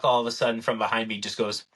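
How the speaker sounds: ordinary talk, nothing marked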